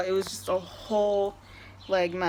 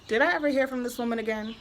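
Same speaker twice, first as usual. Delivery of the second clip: ordinary delivery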